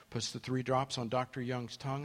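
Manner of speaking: ordinary delivery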